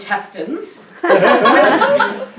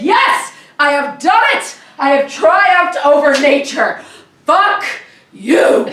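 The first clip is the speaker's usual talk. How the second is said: hammed-up victory cry, higher pitched; triumphant proclamation; 4 semitones higher, 4 dB louder, slower than usual. Climber atop the rock defying nature, grandly triumphant. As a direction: declare conquest grandly, punching each phrase.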